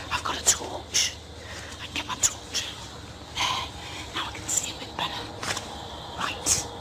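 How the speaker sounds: hushed whisper; quiet nervous whisper. Real, not acted. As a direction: whisper it, still wary. Whispering alone in dark woods.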